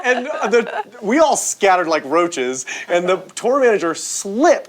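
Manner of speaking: usual speaking voice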